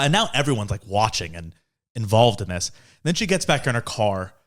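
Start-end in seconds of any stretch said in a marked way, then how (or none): none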